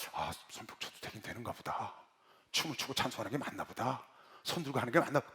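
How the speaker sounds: low, hushed thinking aloud; private musing. Retold or imitated. Newcomer privately accepting clapping and dancing in worship, quiet musing. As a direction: say it softly to yourself, tentatively conceding. Retold inner thought, tentative acceptance.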